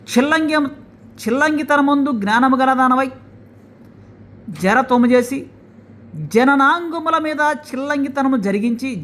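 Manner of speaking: loud, slow, declaiming preacher; forceful scripture reading. Acted. Preacher reading a harsh verse aloud to viewers, loud and declaiming. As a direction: proclaim the verse to the whole hall.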